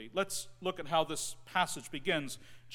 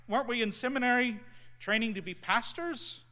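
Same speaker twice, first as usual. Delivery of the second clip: ordinary talk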